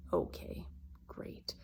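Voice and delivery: whispered, dry and bitter; a real whispered okay, great; sarcastic whisper. Real, not acted. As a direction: breathe it out as a sour aside. Sarcastic aside after his reply.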